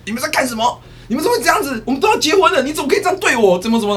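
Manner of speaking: high, loud accusing cries; acted betrayed fiancé. Client bursting in on his cheating fiancée, loud hurt accusations. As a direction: storm in and confront the cheaters. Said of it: "然后要骂的时候".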